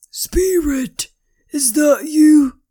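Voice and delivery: high friend's voice. Acted. Hidden friend whispering behind fence.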